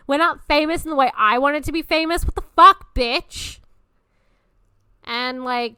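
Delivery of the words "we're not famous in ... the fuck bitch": fast, loud, high indignant rant; outraged disbelief. Acted. Younger self confronting her present self, outraged disbelief. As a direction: berate in hammed-up outrage.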